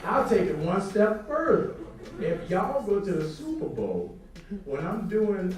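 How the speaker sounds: loud challenge. Retold or imitated. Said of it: "he was like".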